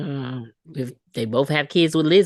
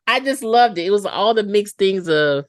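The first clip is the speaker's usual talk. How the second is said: ordinary talk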